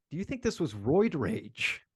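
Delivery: normal talking voice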